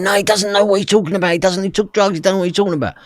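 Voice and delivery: high, urgent, insistent protest; retold frantic pleading; frantic denial. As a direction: cover for someone in a panic. Brother frantically covering for father.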